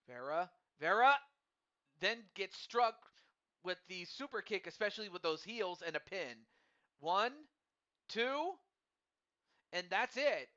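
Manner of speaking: loud, high play-by-play at the finish; excited commentary. Real, not acted. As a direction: call the pin like a hyped commentator. Live match finish, commentator.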